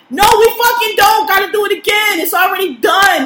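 loud, high, furious refusal; angry shout. Real, not acted. Yelling at coworkers who want the job redone, angry shout. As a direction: bark it out, fed up and done. Ranting about redoing finished work.

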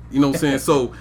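normal talking voice

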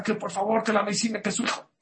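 high-pitched pleading without real volume; mock frantic begging, phrases tumbling out; imitated high, desperate plea; retold pleading cry. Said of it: "le empezó a gritar".

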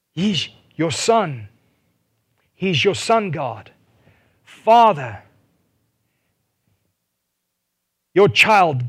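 rising to a loud, high plea; pleading loudly for the child; impassioned cry. Acted. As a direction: cry it out to the Father. Voicing the Spirit crying out.

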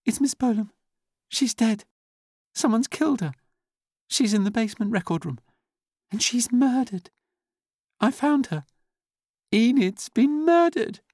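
very high, loud, distinct announcement; shocked young typist's high, ringing clarity; absolute clearness. A narrator's reading. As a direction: announce it high and loud, each phrase set apart. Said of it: "said with absolute clearness".

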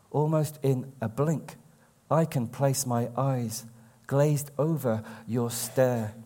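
slow, lifted poetry reading voice; poem reading. Acted. Poet reading his own verse to an audience, in a slow recital voice. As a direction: savour each line like a recital.

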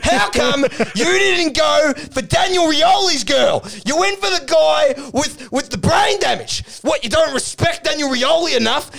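high, shouted mock confrontation; retold angry accusation. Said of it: "throws the table up and starts pointing".

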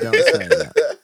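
squealed high and loud; high shriek. Real, not acted. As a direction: shriek it out through laughter. Repeated plea while laughing.

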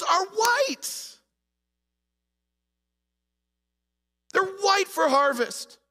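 loud, high, slow pulpit cadence; full-force preaching. Acted. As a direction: preach it to the whole room.

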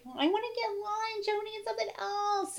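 mock child's whine; whining kids. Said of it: "our kids were like".

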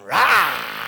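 short, sudden lion roar; low roar of a lion. Acted. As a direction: burst out with a roar. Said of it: "instead of them going".

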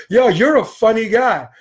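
loud, high, drawn-out challenge; retold taunt. Teen returning to confront the man who joked, loudly taunting. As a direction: imitate a cocky teen picking a fight.

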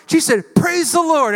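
high, loud joyful shout; old woman shouting over the groceries. Retold or imitated. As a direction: shout praise up to heaven. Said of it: "And she shouted".

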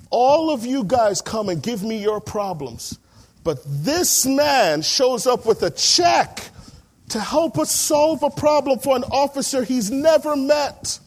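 high, loud, slow, moved exclamation; emotional outburst. Retold or imitated. The inspector praises the pastor to the other pastors, loud and moved. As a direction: let it pour out, voice lifted with emotion.

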